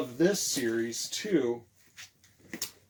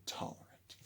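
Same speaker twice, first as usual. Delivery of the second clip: whispered word; 21 dB quieter than usual. Shown as a demonstration. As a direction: whisper the word "Tolerant" softly for the children. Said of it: "See that word there?".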